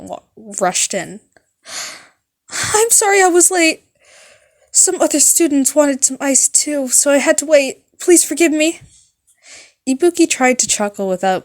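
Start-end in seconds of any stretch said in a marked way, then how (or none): 2.5-8.8 high, loud panicked apology; high anxious girl voice, loud; nervous nurse. Acted. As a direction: gush out the apology in a fluster.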